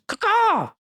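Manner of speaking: high-pitched squawking caw; Falco bird call. Acted. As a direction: let out a sudden, piercing bird cry. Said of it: "Think Falco from Super Smash Brothers".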